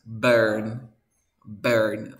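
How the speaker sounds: "burn" drilled with an E vowel; teacher repeating "burn" with an E sound. Shown as a demonstration. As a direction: say "burn" firmly, u like E. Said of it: "Todas tienen este sonido tipo E".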